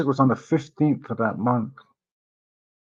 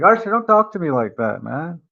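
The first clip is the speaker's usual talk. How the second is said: retold protest, high and louder; indignant warning; 5 semitones higher, 5 dB louder than usual. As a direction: push back at a friend in a retold quarrel. Acted quarrel with drunk friend.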